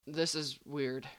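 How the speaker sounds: soft, slow murmur; muffled murmur. Acted. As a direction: mumble it into a shoulder. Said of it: "said into Nathan's neck".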